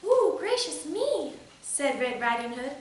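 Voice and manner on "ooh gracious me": put-on high, slow little-girl exclamation; coy storybook girl taken aback. A narrator's reading. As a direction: gasp it out primly, high-pitched.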